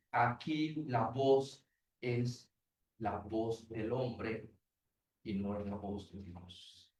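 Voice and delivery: low, slow, mocking voice of man's pride; low slow mimicry of a godless claim. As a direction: drop low and drag it out, mimicking the proud. Preacher voicing man's proud claim.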